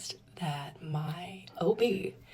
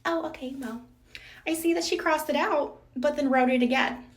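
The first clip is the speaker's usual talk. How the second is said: mock staff voice; 7 semitones higher, 7 dB louder than usual. Staff voiced in retold story.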